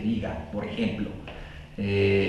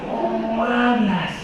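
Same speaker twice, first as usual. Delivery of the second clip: higher and slow, in an odd put-on voice; 7 semitones higher, much slower than usual. Shown as a demonstration. Speaker asking the audience in a put-on voice, higher and slow. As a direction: drag the question out in a funny voice.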